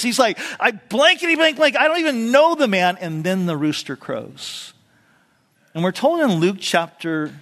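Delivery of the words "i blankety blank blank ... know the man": higher, louder mock swearing; cussing denial. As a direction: blurt it out like a curse. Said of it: "Peter literally cusses".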